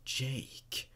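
breathy voiceless whisper of the name; eerie whispered name. A narrator's reading. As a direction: whisper it like a ghost in the song. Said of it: "I heard someone whisper".

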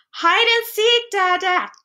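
put-on high, slow playful child's voice; a little boy calling out a game, high and drawn out; child's cry. A narrator's reading. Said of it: "cried Gip".

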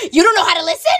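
high-pitched retold indignant retort. Emily to a boy who missed her name, indignant.

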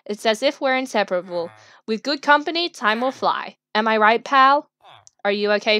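normal talking voice

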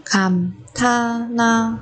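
감탄하다 with a pause after each syllable. Shown as a demonstration. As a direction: separate every syllable of 감탄하다 for learners. Teacher models word syllable by syllable.

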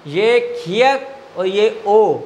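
खियक और ओ spelled slowly, word by word; slow spelling. Shown as a demonstration. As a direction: spell each part deliberately. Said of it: "slow spelling drill". (धीरे धीरे हिज्जे)

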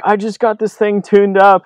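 high-pitched mock panic; imitated freak-out. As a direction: mimic a friend protesting, voice way up. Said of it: "So he starts freaking out".

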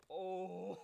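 a loud, high, shocked "Oh!" at the gift; surprised shout. Real, not acted. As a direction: burst out in real surprise. Opening the gift, big surprise.

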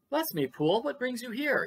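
surprised cry. A narrator's reading. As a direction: greet an unexpected visitor with astonishment. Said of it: "he cried".

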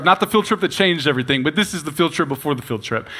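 ordinary delivery

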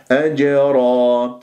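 أجرا slow with bouncing جيم; qalqala demo. Shown as a demonstration. Teacher showing qalqala on أجرا. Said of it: "هكذا بهذه الطريقة".

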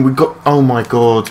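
ordinary delivery

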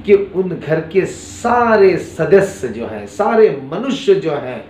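ordinary talk

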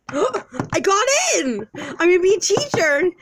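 high, gasping squeal of joy; hammed-up delighted cry; play-acted excitement. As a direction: burst out with thrilled good news. Character learns he got the job.

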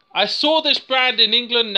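normal talking voice

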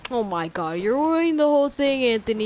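usual speaking voice

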